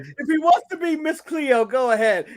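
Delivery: put-on loud, lilting Miss Cleo-style Jamaican accent. Host mimics a TV fortune teller, hammed-up Jamaican accent. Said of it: "You just did a Jamaican accent".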